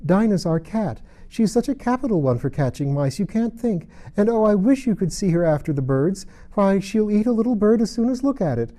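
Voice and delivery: high, loud, eager chatter; Alice eagerly boasting about her cat. A narrator's reading. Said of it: "Alice replied eagerly".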